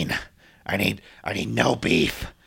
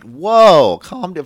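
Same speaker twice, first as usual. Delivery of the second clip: mock startled; 7 semitones higher, 10 dB louder, much slower than usual. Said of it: "like, smiling".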